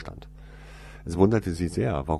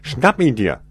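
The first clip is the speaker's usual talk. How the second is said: louder, higher command; loud shout; 6 semitones higher, 12 dB louder than usual. A narrator's reading. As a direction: yell the order after him. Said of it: "rief die Frau laut".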